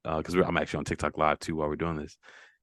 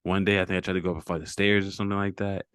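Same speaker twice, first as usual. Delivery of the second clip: ordinary talk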